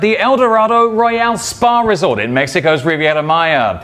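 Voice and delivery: bright, high-energy TV welcome; upbeat travel show host. Acted. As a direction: open the show like a cheerful host. Live show opening welcome.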